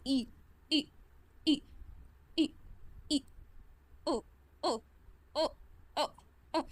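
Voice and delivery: the Korean vowel written "ई" and "ओ", said through clenched teeth; teeth together. Shown as a demonstration. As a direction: hold teeth on teeth for each short, separate "ई" and "ओ". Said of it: "दांत पे दांत रखो". (दांत पे दांत रखकर "ई", "ओ")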